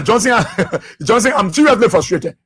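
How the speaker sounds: talking through real chuckles; laughing. Real, not acted. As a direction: laugh while insisting on it. Amused by listener's comment, laughing.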